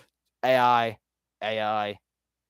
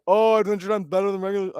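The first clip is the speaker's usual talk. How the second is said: high, loud mock whine of fans; high-pitched mocking imitation; mocking fan quote; 8 semitones higher, 4 dB louder than usual. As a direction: parody everyone's tired opinion, pitched up. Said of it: "everyone's like".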